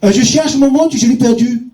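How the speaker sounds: English tourist looking for his watch, in broken French; English accent French. Retold or imitated. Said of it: "un touriste anglais".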